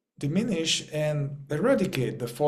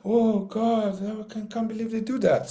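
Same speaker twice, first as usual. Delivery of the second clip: higher, gasping mock disbelief; mock naive shock; 6 semitones higher than usual. Naive worker shocked by betrayal, mock gasping disbelief. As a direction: play the shocked innocent. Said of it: "and you're gonna".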